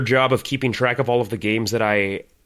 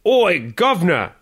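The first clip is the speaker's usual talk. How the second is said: hammed-up British accent, slow; mock Cockney British greeting; 5 semitones higher, 4 dB louder, slower than usual. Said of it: "Do it in a British accent".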